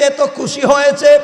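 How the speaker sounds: ordinary delivery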